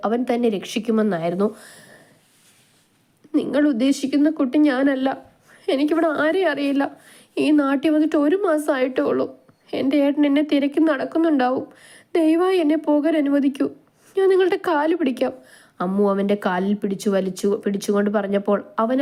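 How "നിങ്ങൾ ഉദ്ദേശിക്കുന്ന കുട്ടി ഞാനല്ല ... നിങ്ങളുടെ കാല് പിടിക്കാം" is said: put-on high girlish entreaty; desperate pleading. A narrator's reading. Captive girl begs the gang boss for release, high and pleading.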